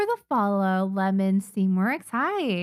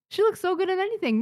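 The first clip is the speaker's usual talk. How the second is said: "She looks so good" much higher and faster; excited; 10 semitones higher, faster than usual. Real, not acted. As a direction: gush "so good in anything" high and quick. Gushing over her character design.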